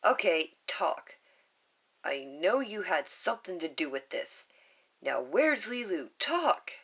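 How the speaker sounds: corner him and make him talk; big sister demanding answers; angry demand. Acted. Nani confronts Stitch.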